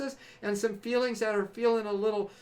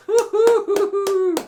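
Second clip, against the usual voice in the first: high, loud drawn-out woo-hoo; whooping cheer; 11 semitones higher, 11 dB louder, slower than usual. Real, not acted. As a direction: whoop like a party crowd. Celebratory whooping.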